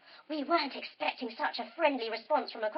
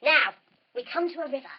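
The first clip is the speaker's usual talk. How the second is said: plain reading voice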